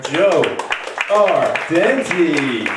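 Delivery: big, slow, drawn-out name announcement; announcer call. Real, not acted. Host bringing his friend onstage, announcer call. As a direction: stretch the name out to bring on the act.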